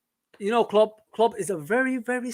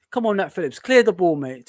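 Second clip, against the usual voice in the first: normal talking voice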